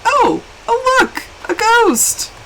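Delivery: high-pitched, lightly drawn-out mock nonchalance; nonchalant. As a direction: imitate someone casually pointing it out, pitched high.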